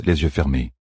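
plain reading voice

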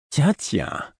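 high, teasing exclamation; sly, playful discovery; mischievous. A narrator's reading. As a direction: tease with lifted pitch. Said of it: "s'exclama-t-elle d'un ton malicieux".

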